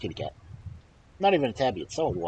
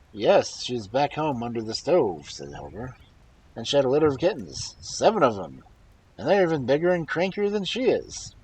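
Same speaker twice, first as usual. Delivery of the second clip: loud, higher call into the forest; shouting back; 5 semitones higher, 9 dB louder than usual. Acted. Halvor shouts back to the troll in the forest. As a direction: call back with a sly boast.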